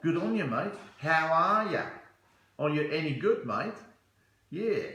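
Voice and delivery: hearty put-on Australian accent; broad comic Aussie twang. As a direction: mimic an Aussie greeting a mate. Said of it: "I love it when they talk like that".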